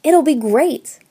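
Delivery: high, loud enthusiasm; exclaiming. A narrator's reading. Student talking her roommate into asking for directions, excited exclamation. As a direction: burst out eagerly. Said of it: "I exclaimed".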